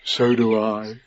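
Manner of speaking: low, soft reply; tender. A narrator's reading. Pooh answering Christopher Robin, tender. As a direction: answer quietly and fondly.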